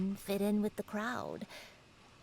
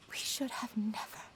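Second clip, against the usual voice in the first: whispering. Acted. Whispered memory voice.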